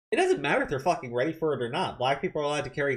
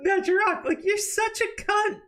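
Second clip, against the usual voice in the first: mock snooty voice; 3 dB louder than usual. Streamer mocks Pelosi brushing the kid off, in high falsetto. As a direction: drag it out in a mocking squeal. Mocking the shove again.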